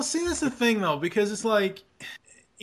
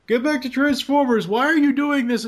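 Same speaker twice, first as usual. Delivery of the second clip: mock commenter whine; 9 semitones higher, 4 dB louder than usual. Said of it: "every single person that comments on his video is like".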